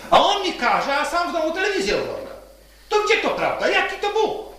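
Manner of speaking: retold indignant protest, pitched high; high, quick, mildly outraged complaint. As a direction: act out a friend's exasperated, high-pitched questioning. Friend's indignant protest, acted out.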